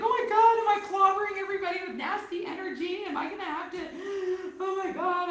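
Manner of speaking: high, loud panicky rush with a half laugh; freaking out. Retold or imitated. Author calling her healer in a panic, freaking out. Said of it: "I was, like, freaking out".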